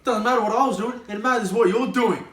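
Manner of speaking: loud, high furious scolding; angry telling-off. Acted. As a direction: lay into him, loud and high. Quarrel over eaten pizza.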